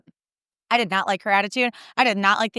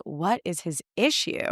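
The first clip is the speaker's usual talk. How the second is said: softer, slow baffled aside; exasperated; 2 semitones lower, 5 dB quieter, slower than usual. Retold or imitated. Sisters wondering about dad's sighing, exasperated and soft. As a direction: roll your eyes at dad.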